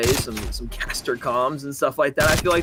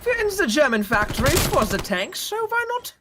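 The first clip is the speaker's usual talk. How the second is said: badly faked German accent, pitched up; hammed-up German accent for a laugh; mock German accent; 12 semitones higher than usual. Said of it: "cast in a German accent".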